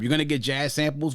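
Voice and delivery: normal talking voice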